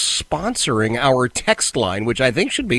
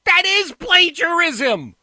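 high-pitched, drawn-out exasperation; exasperated outburst; slower than usual. Real, not acted. Radio host to CNN guest, exasperated and worked up. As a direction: push it up high, fed up and incredulous. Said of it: "I apologize for getting".

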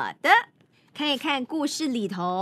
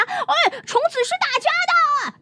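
much higher indignant cry; shouting frogs; 13 semitones higher than usual. Acted. Two frogs chase Milton over the worm, shouting high. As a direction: call after the thief, outraged. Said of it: "他们喊道".

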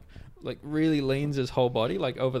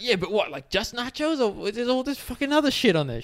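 high, insistent crazed interrogation; crazed squawking; 11 semitones higher, 4 dB louder than usual. Retold or imitated. Crazed man grilling girl about her nachos, squawking. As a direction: imitate a crazed man pressing with questions. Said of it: "kinda crazed voice".

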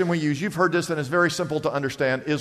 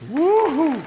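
excited whoop; 10 semitones higher than usual. Real, not acted. Preacher excited by goosebumps.

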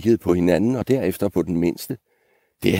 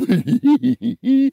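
quavering high goat titter; high bleating giggle; 4 dB louder than usual. Acted. Middle goat crossing the bridge, high bleating giggle.

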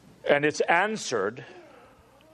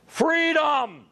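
high ringing cry of freedom; 13 semitones higher, 3 dB louder than usual. Retold or imitated. Dying Wallace crying out to his English tyrants. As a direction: cry it out with his last ounce of strength. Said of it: "he cried out".

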